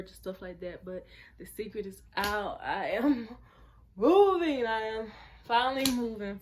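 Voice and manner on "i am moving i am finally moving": louder, laughing reveal; excited laugh. Real, not acted. Announcing her move to viewers, laughing with joy. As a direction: burst out laughing with joy at the news.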